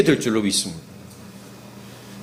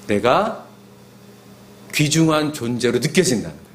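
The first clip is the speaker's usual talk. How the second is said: normal talking voice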